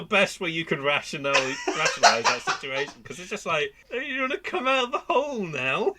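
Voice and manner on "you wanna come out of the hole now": high, tentative coaxing question; mock coaxing. Talking to a man stuck in a hole, mock coaxing. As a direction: gently wheedle someone out of hiding.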